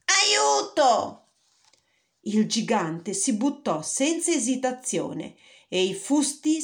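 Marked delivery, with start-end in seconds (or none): 0.1-1.3 piercing high cry, much louder; harp crying out. Acted. Golden harp crying to the ogre, piercing high cry. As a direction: squeal for help as you're carried off. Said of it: "gridava l'arpa".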